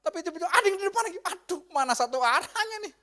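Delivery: high, loud burst of dismay; alarmed protest. Acted. Driver suddenly facing an oncoming car, alarmed.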